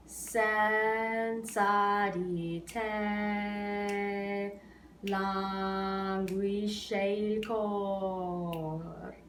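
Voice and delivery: "Senza di te languisce il cor" very slow, counted; spoken in rhythm. Shown as a demonstration. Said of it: "just speaking in rhythm".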